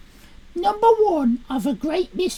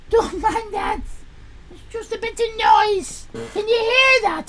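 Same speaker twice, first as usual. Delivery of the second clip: ordinary delivery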